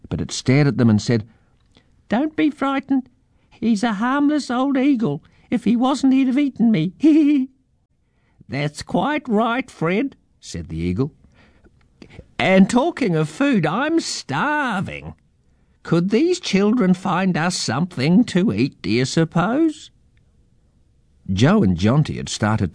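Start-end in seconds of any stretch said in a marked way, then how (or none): 2.1-7.5 very high squeaky mouse voice; timid pink mouse, reassuring. A narrator's reading. Mouse in the pack soothes two children, very high squeak. 8.5-10.2 high, slow bird voice; old eagle. A narrator's reading. Eagle backs up his mouse friend, slow and high. 12.4-19.9 high, drawn-out quirky bird voice; old eagle, hungry. A narrator's reading. Hungry eagle hints children should feed him, high voice.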